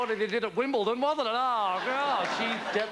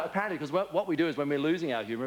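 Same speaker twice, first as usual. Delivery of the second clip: ordinary talk, nothing marked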